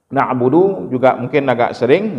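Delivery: ordinary talk, nothing marked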